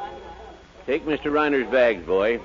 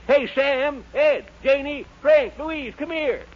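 higher, calling out to the neighbours; 10 semitones higher than usual. Acted. Townsman calls neighbours over with news, raised calling voice. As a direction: hail friends across the street.

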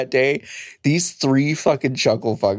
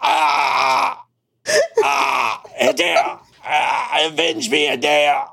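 hammed-up dying gasps, high pitched; overacted death scene; 12 semitones higher, much slower than usual. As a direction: moan out a melodramatic death. Mock Keighley dying on stage.

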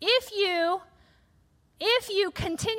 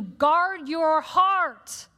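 slow emphatic command; 3 dB louder, much slower than usual. Real, not acted. Preacher commanding slowly.

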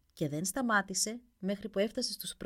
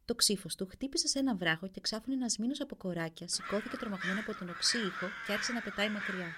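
ordinary delivery, nothing marked

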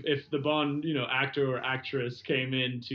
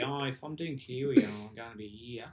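lightly put-on New Zealand accent; attempted Kiwi accent. Shown as a demonstration. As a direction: have another go at a Kiwi accent. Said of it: "I was trying to do Kiwi".

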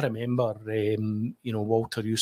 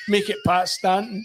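Bobby giving up; 9 semitones higher, 4 dB louder than usual. Retold or imitated. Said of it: "He says".

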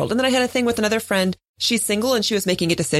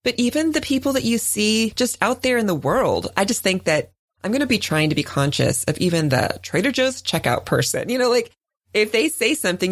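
ordinary delivery

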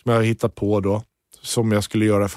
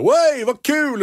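much higher, cheering; delighted cheer; 13 semitones higher, much slower than usual. Retold or imitated. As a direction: whoop it. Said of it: "Peppe jätteglad".

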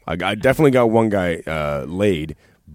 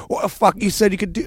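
put-on British accent; 13 semitones higher than usual. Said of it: "that's totally British".

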